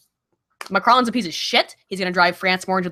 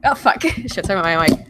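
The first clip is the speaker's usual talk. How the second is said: usual speaking voice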